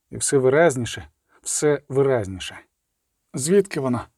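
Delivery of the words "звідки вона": plain reading voice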